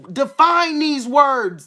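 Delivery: loud, high, slow command; urgent exhortation. Real, not acted. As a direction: urge the listeners, stretching each word out loudly. Breaks off reading to urge listeners.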